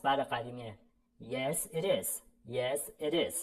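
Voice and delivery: normal talking voice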